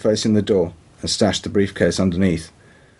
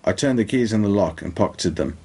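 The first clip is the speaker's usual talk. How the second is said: plain reading voice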